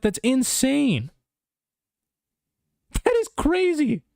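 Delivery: incredulous rant. Real, not acted. Fan ranting about fight card.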